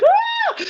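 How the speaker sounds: very high, loud mock yelp; imitated scream. Patient when the dog nudged the leg, mock scream. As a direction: give a short acted scream of pain. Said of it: "I'd scream".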